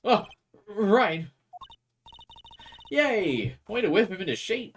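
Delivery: high and loud cheer; excited cheer. Acted. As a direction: cheer them on brightly.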